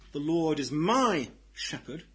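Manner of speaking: "my" held and lifted, then a break; stress on "my". Retold or imitated. Pastor quoting her misplaced emphasis, stressing "my". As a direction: make "my" the word that matters. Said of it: "putting the emphasis entirely in the wrong place".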